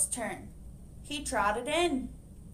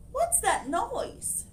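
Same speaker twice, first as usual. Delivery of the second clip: puzzled librarian; 10 semitones higher, slower than usual. Acted.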